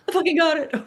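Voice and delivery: high, loud burst of triumph; thrilled exclaim. Retold or imitated. Paul telling friends he landed the gig, high and thrilled. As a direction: shout the good news out. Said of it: "he's like".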